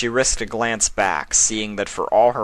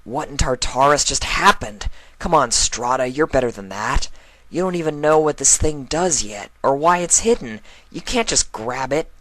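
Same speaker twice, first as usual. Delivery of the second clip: higher, exasperated mare's voice, chiding herself; Strada scolding herself; 7 semitones higher, 3 dB louder than usual. Acted. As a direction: voice the archaeologist telling herself off, higher.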